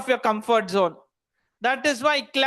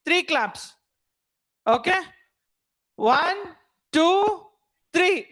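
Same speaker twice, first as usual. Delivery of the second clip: ordinary talk, nothing marked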